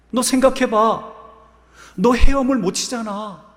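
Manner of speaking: high, loud, indignant turtle outburst; startled yelling. Acted. Startled turtle scolding the scorpion on its back, yelling high. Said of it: "소리를 꽥 질렀지요".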